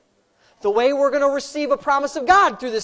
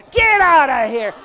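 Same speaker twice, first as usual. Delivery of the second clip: mock bouncer shout; 2 semitones higher, 8 dB louder than usual. Usher throwing people out.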